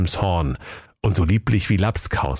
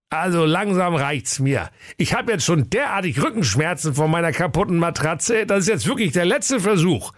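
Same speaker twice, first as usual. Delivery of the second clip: put-on grumpy old king; 7 semitones higher than usual.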